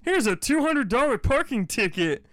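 high, prissy parking officer voice; mock a petty meter maid, smug.

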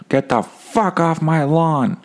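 put-on grumpy old man. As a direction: play a cranky old man shooing kids off the lawn. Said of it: "I like that style of old man".

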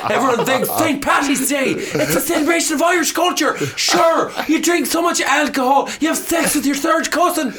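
high, ranting Irish voice; Irish accent. Shown as a demonstration. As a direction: build an indignant rant in a high Irish accent. Irish character rant in accent demo.

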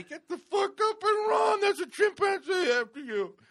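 high, strained mock-terrified shout; panicked urging. Man telling his sleeping body to run from a chimp, panicked shout. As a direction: yell at your own body to flee. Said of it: "you're telling your body".

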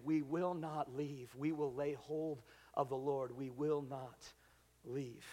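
low, soft, measured vow; solemn resolve. Acted. Worshippers vowing not to leave, quiet resolve. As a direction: lay down each word quietly and firmly.

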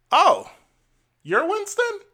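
high squeaky astonished clerk; imitating a surprised clerk; mock surprise. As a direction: act shocked he's the man on the phone. Clerk surprised at his name.

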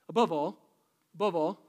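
loud, punched "above all"; emphatic repeat. Real, not acted. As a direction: hammer "above all" home twice.

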